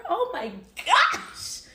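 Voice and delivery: slow, high-pitched exclamation; shocked outburst. Acted. Storyteller reacting to his guilt trip, shocked outburst. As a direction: cry it out high, stretched in disbelief.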